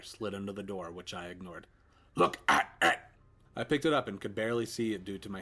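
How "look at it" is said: loud demand. Acted. Voice demands loudly.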